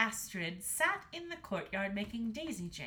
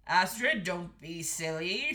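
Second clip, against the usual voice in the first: loud, lightly voiced stern father; 3 semitones lower, 7 dB louder, slower than usual. A narrator's reading. Father scolding the daughter, lightly stern. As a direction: scold firmly, slowing at the end. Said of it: "said her father".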